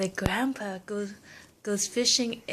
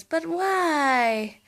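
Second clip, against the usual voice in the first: puzzled grandpa; 7 semitones higher, 4 dB louder than usual. Acted. Grandpa questions wife.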